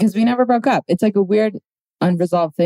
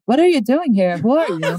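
high, confronting mock Swedish accent; bad Swedish accent; 7 semitones higher than usual. Girlfriend confronting the surprise visitor, bad mock Swedish accent. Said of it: "This is, like, my terrible, that's not even a Swedish accent".

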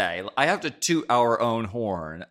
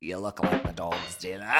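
low, soft put-on Australian accent; mock Australian accent; 7 semitones lower, 7 dB quieter than usual. Co-host repeats the joke to the other, softly in mock Australian. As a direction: drop into a quiet Aussie accent. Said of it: "like, Australian accent".

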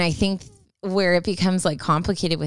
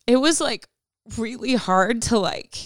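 holding back tears while talking; crying voice; 5 semitones higher than usual. Real, not acted. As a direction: voice breaking, high and teary. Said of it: "this is, like, my crying voice".

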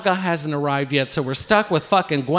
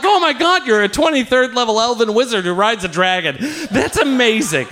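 high-pitched gushing enthusiasm; hammed-up awe; mock amazement; 5 semitones higher than usual. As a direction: gush as if wildly impressed. Feigning amazement at his game character.

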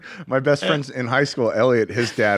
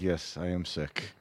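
subdued reply; 7 semitones lower, 13 dB quieter than usual. Retold or imitated. Own sickly reply, retold.

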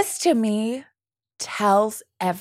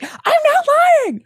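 very high, louder reality-show denial; indignant mock protest; 6 dB louder than usual. Real Housewives star defending herself, indignant. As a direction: protest like an outraged housewife, voice shooting up.

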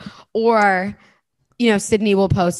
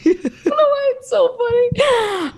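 laughing fit. Real, not acted. Can't stop laughing.

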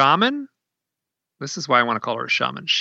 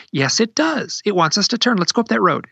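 high-pitched, urgent insisting; insistent wife; 8 semitones higher than usual. Retold or imitated. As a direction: imitate a wife excitedly correcting her husband. Wife arguing back at husband.

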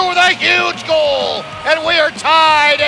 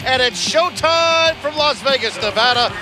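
rapid hockey play-by-play; 11 semitones higher than usual. Acted. Played clips of radio calls.

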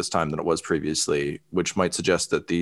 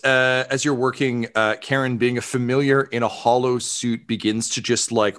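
ordinary talk

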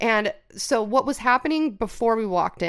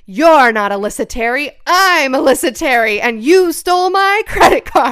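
much louder, firm call-out; loud confrontation; 4 semitones higher, 10 dB louder than usual. Retold or imitated. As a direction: announce yourself loudly to catch the thief. Confronting the card thief at door.

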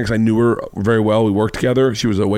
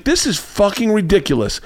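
higher-pitched imitation of her fuming; retold angry rant; 9 semitones higher than usual. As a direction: complain indignantly, mimicking an angry date. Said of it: "like, really angry".

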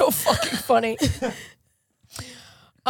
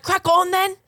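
mock British accent; 7 semitones higher, 3 dB louder, faster than usual. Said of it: "we're all like".

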